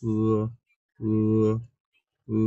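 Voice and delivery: উ said with a long pull; drawn u vowel. Shown as a demonstration. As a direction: stretch উ into a long u. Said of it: "অন্তস্থ জ ফলার মতো হচ্ছে উচ্চারণ করি".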